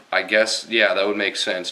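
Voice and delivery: ordinary delivery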